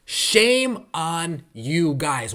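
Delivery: slow, drawn-out Shame on you guys with weight on shame; slow scolding. Real, not acted. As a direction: stretch out each word of Shame on you guys. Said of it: "repeated scolding".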